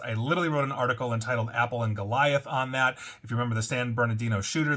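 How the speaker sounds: normal talking voice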